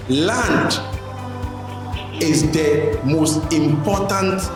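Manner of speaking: ordinary talk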